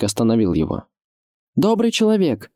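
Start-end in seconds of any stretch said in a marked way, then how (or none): 1.6-2.5 high, ringing noble address; young lord calling up with authority; noble raised voice. A narrator's reading. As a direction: raise the voice to hail the wall. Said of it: "возвысил он звучный благородный голос".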